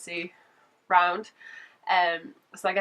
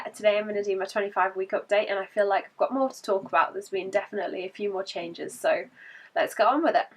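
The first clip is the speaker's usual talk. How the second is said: ordinary delivery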